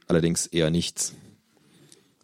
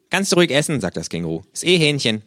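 high, loud cheeky kangaroo greeting; 6 semitones higher, 4 dB louder than usual. Acted. The kangaroo scolds the late narrator in its high cheeky voice. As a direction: snap it out as the brash kangaroo.

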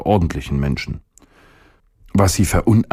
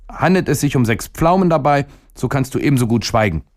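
high-pitched, brisk refusal; defiant boy; 7 semitones higher than usual. A narrator's reading. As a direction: cut the stomach off in a high boyish voice. Boy rejects stomach's proposal.

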